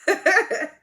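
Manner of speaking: high, loud giggle; squealing laugh at a crush; giddy laugh. Real, not acted. Giddy over the actor's voice.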